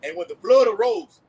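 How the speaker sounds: slow, loud preaching read; scripture reading. Acted. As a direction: proclaim the verse slowly. Reads scripture aloud.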